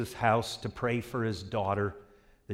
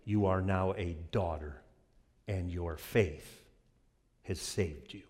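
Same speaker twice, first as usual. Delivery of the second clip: tender Jesus; 5 semitones lower, 8 dB quieter than usual. Acted. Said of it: "and says".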